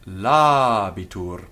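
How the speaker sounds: "labitur" slow, first a held long and loud; long first vowel. Shown as a demonstration. Teacher showing vowel quantity, "labitur" with long a. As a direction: stretch the la of "labitur". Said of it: "first a as long by nature".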